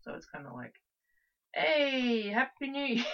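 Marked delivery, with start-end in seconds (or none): 1.5-3.1 bright and high, laughing through it; cheery greeting. Acted. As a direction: throw out a cheerful, laughing New Year greeting. Mock greeting voiced.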